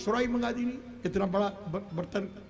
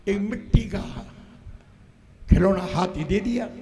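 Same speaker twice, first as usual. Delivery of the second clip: ordinary delivery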